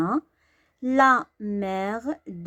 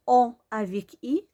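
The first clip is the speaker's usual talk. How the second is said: ordinary talk, nothing marked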